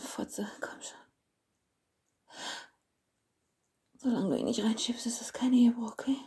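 breathy whisper. Acted. Aroused whisper, higher.

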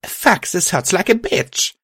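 high, pained complaint; high-voiced wince of pain; pained thought. A narrator's reading. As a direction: curse through the pain, pitch up. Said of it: "I grunted as pain took hold".

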